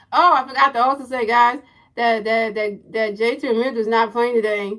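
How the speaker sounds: sudden loud, urgent aside; loud interjection. Real, not acted. Streamer remembers lineup news for the guys, loud interjection. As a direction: burst out with a forgotten update. Sudden announcement to his viewers.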